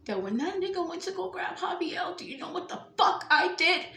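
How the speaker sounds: loud, laughing through an angry outburst; angry shout. Real, not acted. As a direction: shout it out, half laughing in fury. Furious rant at listener.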